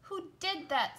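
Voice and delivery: high, loud demand. Acted. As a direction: snap it out like an angry crab. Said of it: "Sebastian ex-, demanded".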